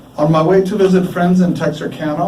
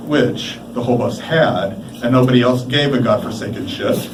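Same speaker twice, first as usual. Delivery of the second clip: ordinary delivery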